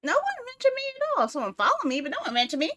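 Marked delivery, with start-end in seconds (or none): 0.0-1.3 high whiny complaint; high indignant whine. Real, not acted. Streamer complaining nobody mentioned her, high and whiny.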